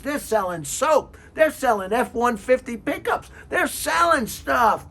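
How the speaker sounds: a rant that climbs to a shout; worked up rant. Real, not acted. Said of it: "sorry if I got a little excited".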